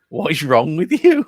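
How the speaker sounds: hammed-up disbelief at a guest; disbelieving. Host staring at the swearing guest, loud disbelief. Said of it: "staring at him going".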